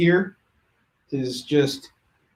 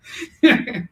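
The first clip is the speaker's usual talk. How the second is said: laugh; 9 semitones higher, 3 dB louder than usual. Real, not acted. Laughs after the bit.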